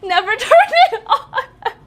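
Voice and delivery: loud, high, drawn out through laughter; laughing self-mockery. Real, not acted. Streamer admitting her treadmill sat unused, laughing loudly. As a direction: crack up at your own laziness.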